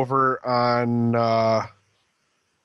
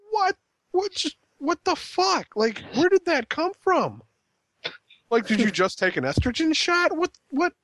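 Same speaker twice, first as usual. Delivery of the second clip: much higher, incredulous what-the-fuck; mock baffled reaction; 13 semitones higher than usual. Viewer reacting to Natalya's sudden sobbing, mock disbelief. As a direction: play a stunned viewer who can't believe it. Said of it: "and you're like".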